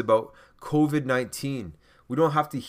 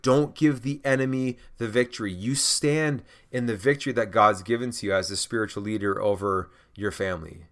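normal talking voice

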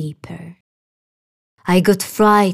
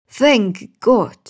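higher, louder cry of relief; relieved exclamation; 4 semitones higher, 4 dB louder than usual. A narrator's reading. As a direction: blurt out thanks in relief. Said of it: "I exclaimed".

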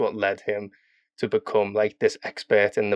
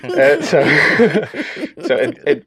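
laughing; 8 semitones higher, 9 dB louder than usual. Real, not acted.

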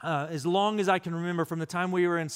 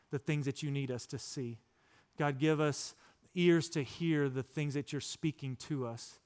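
soft, lowered voice in prayer; quiet prayer; 4 semitones lower, 6 dB quieter than usual. Retold or imitated. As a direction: pray gently and low, pausing between phrases. Demonstrating prayer said with his sons.

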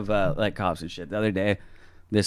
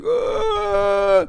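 very high mock cry; put-on high-pitched yell; imitated wail. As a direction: let out a man's wordless high moan. Imitating the man walking in.

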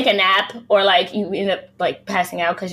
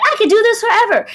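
put-on cocky young streamer; 13 semitones higher, 6 dB louder, faster than usual. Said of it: "So you're probably like".

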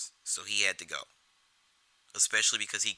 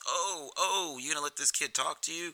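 high, teasing wise-guy jab; mock Jimmy needling; 8 semitones higher than usual. Jimmy goading Tommy about Spider, high teasing jab. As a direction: egg Tommy on with a grin in the voice. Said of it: "Jimmy kinda starts breaking Tommy's balls".

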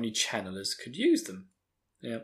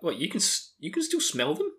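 shocked disbelief; 6 semitones higher than usual. Retold or imitated. Rand reacting to Hurin smelling the trail, shocked. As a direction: pitch shooting up in shocked disbelief. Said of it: "Rand is really shocked".

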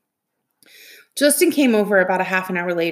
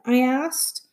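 plain reading voice

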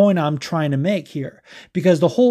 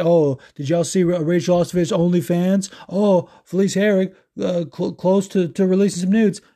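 higher-pitched imitation of gossiping men; mocking leering fans; 5 semitones higher than usual. Host mimicking men leering over female athletes, mocking. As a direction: parrot their lewd excitement in a raised voice. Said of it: "without them being like".

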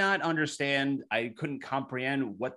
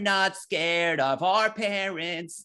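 slow, whiny emo wail; whiny emo singer; 5 semitones higher, much slower than usual. Retold or imitated. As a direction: drag it out like a moody emo singer. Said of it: "they were like".

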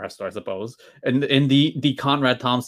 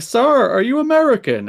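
high, loud student question; quoted curious student; 12 semitones higher, 6 dB louder than usual. Retold or imitated. High school pupils questioning their teacher's accent, mock student voice. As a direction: pipe up like a pupil in class. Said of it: "they were like".

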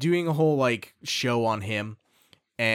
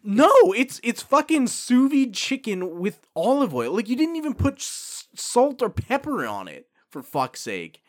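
exasperated rant; 9 semitones higher, 4 dB louder than usual. Retold or imitated. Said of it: "I'm like".

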